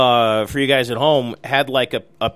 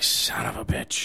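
whispered, muttered curse; 3 semitones lower, 9 dB quieter than usual. Retold or imitated. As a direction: curse under your breath. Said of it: "And I'm like".

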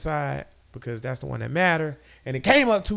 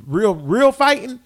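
hyped emphasis; 7 semitones higher, 7 dB louder than usual. Real, not acted. Hyping the fighter.

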